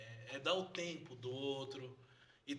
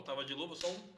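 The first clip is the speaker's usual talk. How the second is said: ordinary talk, nothing marked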